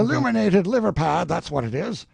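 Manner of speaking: loud, high sneer; mocking. Collector jeering at the Fra Angelico, loud. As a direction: jeer at the picture.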